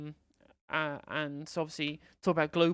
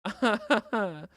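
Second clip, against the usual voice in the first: slow, laughing through the name; amused scoffing laugh; 4 semitones higher, 5 dB louder than usual. Real, not acted.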